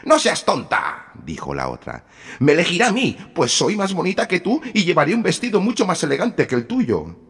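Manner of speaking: high, loud quarrelling sisters; bickering stepsisters. A narrator's reading. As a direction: squabble and sneer. Stepsisters quarrel over the prince.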